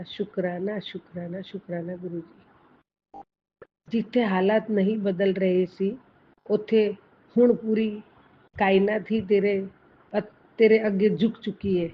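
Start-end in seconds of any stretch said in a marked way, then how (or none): 3.9-11.9 louder, proclaiming message read in Punjabi; a devotee reads out the Guru's message, proclaiming; reading a blessing message. Acted.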